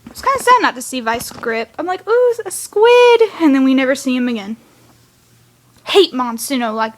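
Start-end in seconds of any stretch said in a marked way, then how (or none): 2.1-3.2 high, drawn-out, louder squeal of interest; excited reaction. Retold or imitated. Fan acting out seeing a squid, excited. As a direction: ooh it loudly, pitched way up. Acts out her delighted reaction.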